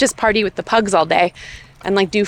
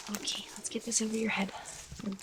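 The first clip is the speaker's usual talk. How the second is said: soft whisper; 17 dB quieter, faster than usual. Real, not acted. Dressing the dog quietly.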